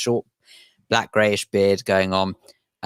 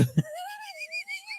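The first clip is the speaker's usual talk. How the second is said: shrill burst of laughter, much higher; high squeal of laughter; 13 dB quieter than usual. Real, not acted. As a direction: squeal with delight at the joke. Co-host cracks up after reading.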